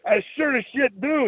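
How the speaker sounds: high, hammed-up Scottish bellow; bellowing Scottish brogue. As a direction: boast it like a goofy Scotsman. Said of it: "he bellowed".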